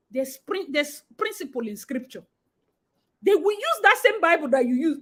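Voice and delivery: ordinary delivery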